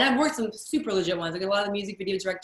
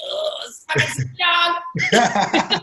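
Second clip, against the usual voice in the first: louder, higher mock freak-out through laughter; starstruck outburst; 5 semitones higher, 5 dB louder, much slower than usual. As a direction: gush it slowly, laughing at your own awe. Retells being starstruck meeting [name].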